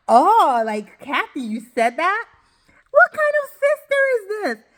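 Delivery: high-pitched imitation of shocked disbelief; gasp-like "oh" climbing into a high, incredulous question; mock shock. [name] reacts to her sister Kathy, mock appalled disbelief.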